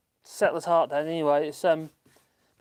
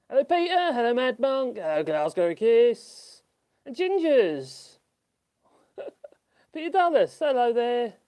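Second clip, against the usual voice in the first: high, loud excited hellos; cheery greetings; 9 semitones higher, 6 dB louder, slower than usual. Real, not acted. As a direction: greet each pet brightly. Greeting the birds or pets.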